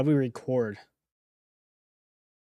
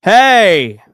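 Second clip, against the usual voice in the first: sudden loud call of hey; startling shout in the silence; 4 semitones higher, 13 dB louder than usual. Shown as a demonstration. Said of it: "The hay is gonna be hard".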